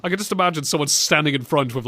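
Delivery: usual speaking voice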